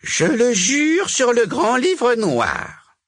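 slow, high, loud witch's vow; reluctant solemn oath. A narrator's reading. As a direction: force out each word of the oath. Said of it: "déclara Vipera à contre-cœur".

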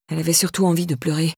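ordinary delivery

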